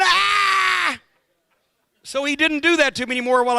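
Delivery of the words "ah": high yelled scream of a scared friend; imitated panic scream. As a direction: scream back in terror, mimicking him. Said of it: "And now he's going".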